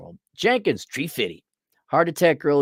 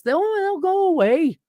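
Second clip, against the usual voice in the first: higher, animated reassurance; raised excited pitch; 13 semitones higher than usual. Real, not acted. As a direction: lift the voice up high. Long stretch in raised voice.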